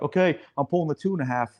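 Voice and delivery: eager firefighter. Retold or imitated. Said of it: "will be like".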